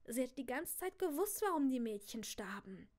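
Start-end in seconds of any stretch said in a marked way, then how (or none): none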